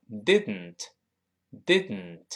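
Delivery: "didn't" with d released through the nose; slow, nasal release on "didn't"; nasal plosion. Shown as a demonstration. Teacher demonstrates nasal plosion in "didn't". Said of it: "读快了就要变成".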